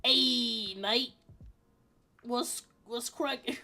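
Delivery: badly faked Aussie greeting; loud Aussie hail, then slow and faltering; bad Australian accent. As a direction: attempt a terrible Australian accent. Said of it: "accents again. I'm terrible at them".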